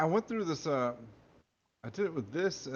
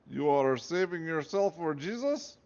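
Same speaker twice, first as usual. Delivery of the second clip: high pleading fake Russian accent; hammed-up Russian accent; 7 semitones higher than usual. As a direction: beg the commander in a thick hammed-up Russian accent. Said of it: "the Russian accent there".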